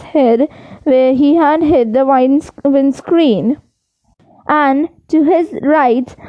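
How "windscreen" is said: ordinary delivery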